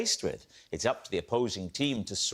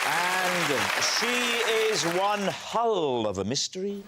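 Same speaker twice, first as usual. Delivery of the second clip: upbeat TV panel-show introduction; show host announcer; 8 semitones higher, 3 dB louder than usual. Acted. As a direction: lift each guest's name for applause.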